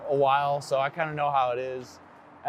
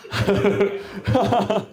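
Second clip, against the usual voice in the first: laughing; 8 semitones higher, 5 dB louder, slower than usual. Real, not acted. Jokes with crowd, laughing.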